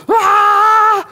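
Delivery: loud, high shriek of panic; terrified scream. Retold or imitated. Jünger sehen den Schatten auf dem Wasser, gellender Angstschrei. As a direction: scream like a fisherman seeing a ghost.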